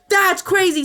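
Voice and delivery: high, loud, scandalised outburst; real amazement. Real, not acted. Streamer reading gossip about a found username, amazed. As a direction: exclaim in gleeful disbelief.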